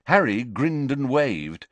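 ordinary delivery, nothing marked

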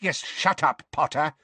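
high, curt bark; barked order. A narrator's reading. Fudge silences Potter, barking. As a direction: snap it out impatiently. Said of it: "barked Fudge".

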